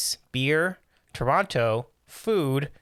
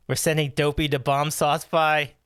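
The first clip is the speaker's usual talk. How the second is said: usual speaking voice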